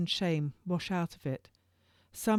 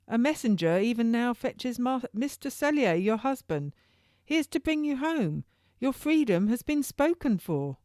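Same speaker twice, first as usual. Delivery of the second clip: put-on much higher, louder young jailer; turnkey's voice; 7 semitones higher, 4 dB louder than usual. Young turnkey telling Madam Cellier she is free, high and loud. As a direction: announce it grandly, slowing on hear my words. Turnkey tells of her husband.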